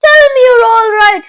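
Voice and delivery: high, loud sobbing plea; tearful cry. A narrator's reading. Mother sobs, begging her son to answer. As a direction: near tears, begging. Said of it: "cried Missus T V".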